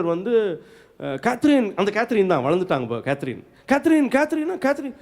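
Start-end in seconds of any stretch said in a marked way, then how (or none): 3.7-4.9 high, lilting call of her name; affectionate calling. Retold or imitated. Husband back from retreat calling his wife, affectionate and high. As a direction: call out sweetly, far above usual pitch. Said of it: "இப்போ அன்பா கூப்பிட்றாரு".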